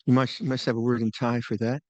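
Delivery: ordinary talk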